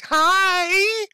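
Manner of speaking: very high, loud chirpy hi; mock Twitter voice. Imitating the barefoot stranger's shrill greeting. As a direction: squeal a creepy cheerful hi. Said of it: "in a Twitter voice".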